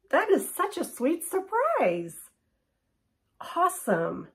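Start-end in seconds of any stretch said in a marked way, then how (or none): 0.1-2.2 high, thrilled squeal of surprise; delighted surprise. Real, not acted. Unboxing vlogger gushing over an unexpected credit, delighted surprise. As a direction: gush over a sweet surprise.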